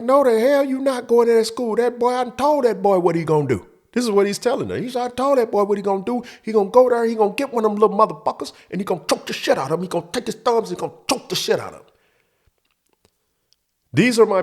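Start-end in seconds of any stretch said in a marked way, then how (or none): 0.0-3.7 stepdad yelling. Retold or imitated. Stepfather overruling mom. 5.0-8.5 stepdad ranting. Retold or imitated. Stepdad ordering violence. 8.7-9.8 fast rant. Retold or imitated. Stepdad ranting, fast. 9.8-10.7 stepdad ranting. Retold or imitated. Stepdad ordering violence. 10.7-11.9 fast rant. Retold or imitated. Stepdad ranting, fast.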